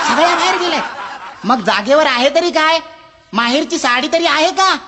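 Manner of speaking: high, laughing echo of सगळ्या बाहेर गेल्यात that falls on बाहेर; can't believe his ears; disbelieving customer. Acted. Customer repeats the librarian in high, amused disbelief.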